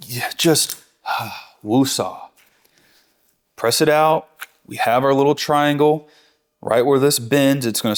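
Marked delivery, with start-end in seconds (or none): 0.1-2.2 slow whispered woosah with a sigh; a mock self-soothing whisper; calming woosah. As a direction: breathe out and whisper to calm yourself down. Calming himself after sound effects.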